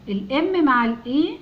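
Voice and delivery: usual speaking voice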